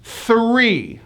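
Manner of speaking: Three as a drawn-out thuh-REE; Three, falling on a long EE; TH-R blend. Shown as a demonstration. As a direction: clear TH, hard R in Three. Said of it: "it's a T H R blend".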